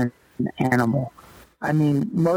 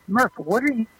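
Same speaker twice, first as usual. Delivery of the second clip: higher, animated retold question; clubhouse manager, puzzled; 7 semitones higher than usual. Manager puzzled at the rookie sprinting in, higher animated voice. Retold locker room exchange.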